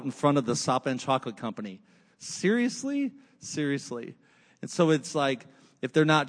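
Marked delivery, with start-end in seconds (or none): 2.2-3.1 high, rising disbelief on Seriously?; incredulous seriously. Acted. Preacher to congregation about stolen baby Jesus, incredulous. 3.4-4.2 deadpan answer. Acted. Answers own question, deadpan.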